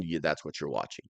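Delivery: ordinary talk, nothing marked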